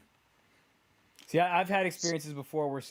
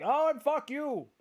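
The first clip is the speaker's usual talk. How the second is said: mock yell; 10 semitones higher, slower than usual. Friend imagines heated exchange.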